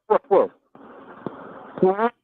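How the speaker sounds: ordinary talk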